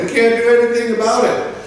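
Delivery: high, quick brush-off; mock helpless staff. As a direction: imitate a clerk pleading helplessness. Imitated unhelpful staff.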